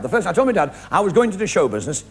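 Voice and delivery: normal talking voice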